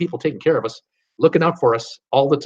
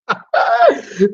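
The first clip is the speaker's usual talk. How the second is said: high laugh; 9 semitones higher, 4 dB louder than usual. Real, not acted. Laughing at windy Reno.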